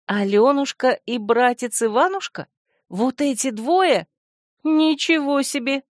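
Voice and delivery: high amazed disbelief; astonished. A narrator's reading. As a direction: gasp it out in shock. Heroine amazed at fairy-tale names.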